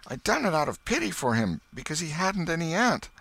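put-on high boy's voice, earnest; a cheeky kid pleading his case; boy's excuse. A narrator's reading. Boy justifies himself to his aunt, high and earnest.

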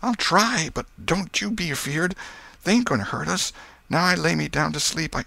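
put-on high, nervous boy's voice; anxious boy starting a bedtime prayer; Tom, frightened boy praying. A narrator's reading. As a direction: brave face on high-pitched fear.

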